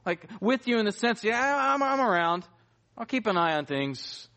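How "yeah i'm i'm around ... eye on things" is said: casual bystander. Acted. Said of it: "he's not standing off on the side".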